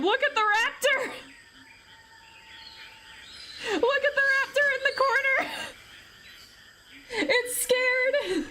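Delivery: high-pitched cooing squeal; delighted squeal. Real, not acted. Streamer spots a little raptor in the corner, delighted squeal. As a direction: squeal with delight at something tiny and cute.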